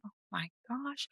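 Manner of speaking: barely voiced inner gasp of dread; hushed dismay. Patient recalling the doctor's news, hushed and dismayed. Thinking after hearing scary news.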